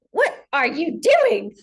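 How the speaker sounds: a puzzled big cat asking, drawn out; lion's question. Retold or imitated. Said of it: "and he says".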